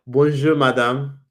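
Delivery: slow, careful French accent on Bonjour, madame; drawn-out French-accented Bonjour with a rise; French greeting. Shown as a demonstration. Answering a French fan in a French accent. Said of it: "I need to practice my French".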